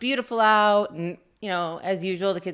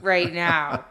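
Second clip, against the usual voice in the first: retold yell; 6 semitones higher, 3 dB louder, much slower than usual. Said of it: "and I scream down".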